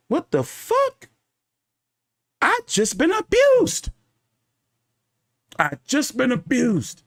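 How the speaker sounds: high, stretched-out disbelieving cry; shocked disbelief. Retold or imitated. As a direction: replay the shock of realizing he got hit, up high and slow. Retelling moment he noticed hammer blows.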